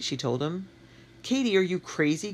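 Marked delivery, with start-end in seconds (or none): 1.2-2.3 cried out. A narrator's reading. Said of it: "Q cried suddenly".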